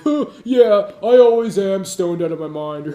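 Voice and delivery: dumb, loud, agreeable voice; hammed-up dopey pushover, loud; mock dopey pushover. Mocking a pushover who agrees he's always stoned.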